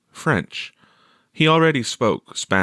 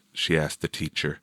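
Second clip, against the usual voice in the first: ordinary delivery, nothing marked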